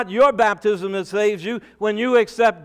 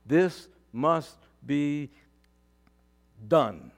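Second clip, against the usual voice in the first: slow, low, solemn words of Jesus; 4 semitones lower, slower than usual. Acted. A pastor voicing Jesus to his congregation, slow and solemn. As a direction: lay each word down slowly, gravely. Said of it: "And Jesus said".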